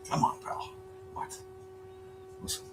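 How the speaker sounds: very high, soft cooing; cooing at pet. Real, not acted. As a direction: owner coaxing his cat off his lap. Coaxing the cat.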